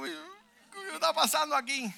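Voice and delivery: high, slow, mock-weeping wail; thin, drawn-out crying imitation; tearful. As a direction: imitate a woman crying in bewilderment. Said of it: "Y la señora llorando".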